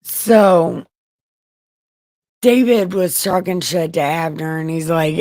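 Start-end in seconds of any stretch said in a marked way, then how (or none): none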